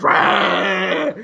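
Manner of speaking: drawn-out cartoon tiger roar; imitated cat roar. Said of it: "the cat gets a big red helmet and goes".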